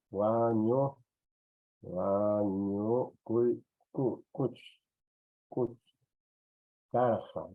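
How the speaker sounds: "wa ñukuy kuch karqa" broken up with long pauses; slow, halting sound-out, repeating "kuch" on its own; syllable-by-syllable dictation. Shown as a demonstration. Teacher dictates word in syllables.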